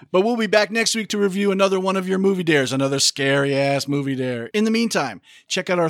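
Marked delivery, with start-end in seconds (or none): none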